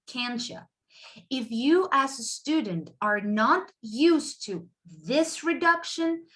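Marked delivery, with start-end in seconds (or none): 0.1-0.6 "can't ya" quick and soft, "you" becomes "ya"; reduced. Shown as a demonstration. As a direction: squash "can't ya" together. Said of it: "we would say something like".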